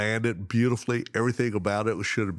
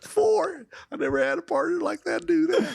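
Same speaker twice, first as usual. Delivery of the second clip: mocking falsetto. Guide taunting the angler about the misses in a giggling falsetto. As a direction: squeal the taunt, fast and gleeful. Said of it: "He starts giggling".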